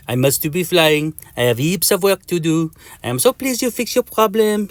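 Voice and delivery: chatty, meepy French mosquito; Mister Mosquito. Acted. Mosquito makes small talk, meepy French voice. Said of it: "in a meepy voice".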